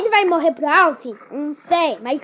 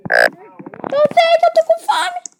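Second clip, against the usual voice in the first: whiny wail; 12 semitones higher, 6 dB louder, slower than usual. Pretend. Wailing about hunger in game.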